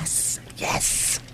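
soft, drawn-out victorious yes; hushed triumph. Real, not acted. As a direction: gloat under your breath. Host gloats at guest's praise.

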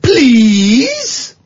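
high, drawn-out mocking "Please?"; mock snippy please. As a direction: sarcastically demand manners with a rising "Please?". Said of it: "And she said".

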